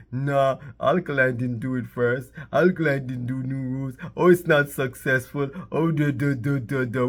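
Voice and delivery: put-on voice of whining naysayers; mock the critics, ending in dismissive duh-duhs; mocking the doubters. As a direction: imitate a silly complainer. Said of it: "tell them to be like".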